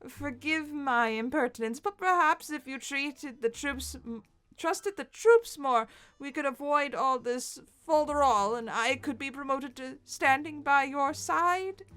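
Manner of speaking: put-on a servant's oily flattery in an English accent. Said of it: "English accent".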